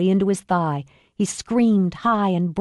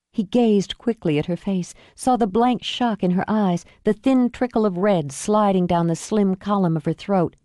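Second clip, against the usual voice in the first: plain reading voice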